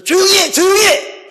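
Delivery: high, loud acted call for silence; shouted order. Retold courtroom order to the man screaming at the back, high loud shout.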